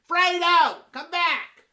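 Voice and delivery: loud summons; calling out. Acted. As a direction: call the waiter back.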